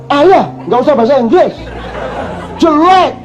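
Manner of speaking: put-on high dismissive child voice; daughter's scolding. Daughter telling father to stop speaking English, high and dismissive. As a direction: brush dad off, pitched high.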